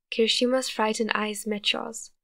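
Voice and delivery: plain reading voice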